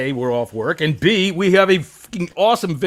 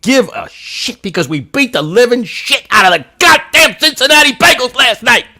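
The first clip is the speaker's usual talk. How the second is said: loud, high, jubilant yelling; excited shout; 7 semitones higher, 9 dB louder than usual. Real, not acted. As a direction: crow about the big win. Excited host after big win.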